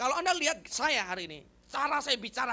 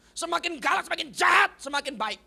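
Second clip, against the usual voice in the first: loud, high, punched key words; drilled-in slogan; 6 semitones higher, 7 dB louder than usual. Retold or imitated. Preacher repeating his upbringing's motto, loud and emphatic. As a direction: hammer out the harsh family motto. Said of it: "saya besar dengan cara begitu".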